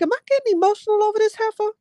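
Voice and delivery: shrill-high squeal, fast and rushed; mock squeal. Streamer mocking her own surprise at tearing up, very high and fast. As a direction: squeak it out in a rush of disbelief. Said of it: "I'm like".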